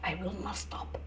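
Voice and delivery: low, whispered refusal; hushed defiance. Acted. Ivy refusing her boyfriend's plea to stop, hushed defiance. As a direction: refuse under your breath, barely voiced.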